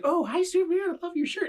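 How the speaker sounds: sweet server greeting. Retold or imitated. Retelling the server greeting his shirt, in a high cute voice. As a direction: coo at the shirt like it's a friend. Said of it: "it was so cute, 'cause she's like".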